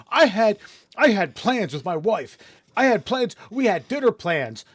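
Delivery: high, loud indignant whine; mock pissed off. Officer complains about ruined evening, mock pissed off. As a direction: imitate a wronged husband, hammed up. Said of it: "got all pissed off".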